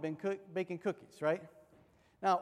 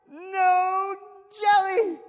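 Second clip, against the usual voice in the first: high, loud, slow No jelly!; mock shout; 11 semitones higher, 11 dB louder, much slower than usual. Brother taunting little Steve, loud high slow shout. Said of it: "He says".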